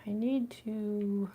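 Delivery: ordinary talk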